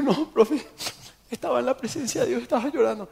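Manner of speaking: voz aguda de alumno lloroso; explicación llorosa imitada. Retold or imitated. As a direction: imita tu propia excusa entre lágrimas, en tono agudo. Se imita llorando ante el profesor. (llorosito, agudo, fingido)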